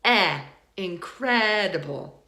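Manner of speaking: in-CREHD-uh-bull for "incredible", drawn out; short stressed vowel. Shown as a demonstration. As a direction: isolate eh, then slow "incredible" with a long CRED. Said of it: "It sounds like a short vowel".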